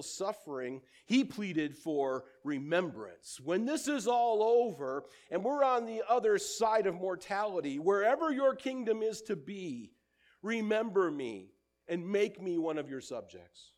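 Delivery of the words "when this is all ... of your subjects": higher and louder pleading; loud impassioned plea of the dying thief; fervent plea. Acted. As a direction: plead with all your heart.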